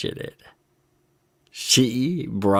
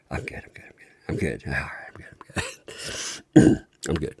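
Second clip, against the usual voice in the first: whispering; 11 semitones lower, 6 dB quieter, much faster than usual. Real, not acted. Calming herself after laughing.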